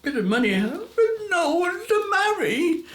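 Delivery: high, tearful lament, slowing into a wail; lonely woman crying. A narrator's reading. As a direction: cry out her loneliness. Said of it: "a woman crying".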